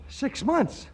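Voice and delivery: jolted by the news; panicked squeak of disbelief; shocked. Acted. Shocked at going broke.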